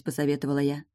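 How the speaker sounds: usual speaking voice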